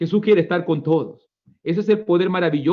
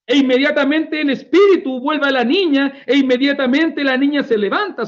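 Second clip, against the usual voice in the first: high, loud fervent preaching; rising fervent sermon climax; preaching at full force; 9 semitones higher, 4 dB louder than usual. Real, not acted. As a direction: proclaim it to the congregation.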